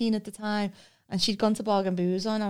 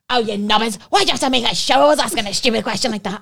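much louder, exasperated telling-off; embarrassed scolding; 3 semitones higher, 9 dB louder, faster than usual. Retold or imitated. Mate scolding her in the winery, loud embarrassed telling-off. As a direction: scold a friend for showing you up. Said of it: "And my mate was going".

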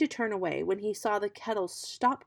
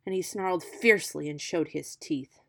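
ordinary delivery, nothing marked

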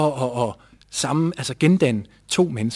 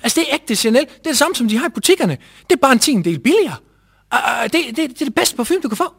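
high, louder hard sell; pushy father sales pitch; 9 semitones higher, 3 dB louder than usual. Retold or imitated. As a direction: push the perfume like a salesman. Father voiced pushing the perfume. (far presser på som sælger, ivrigt og højt)

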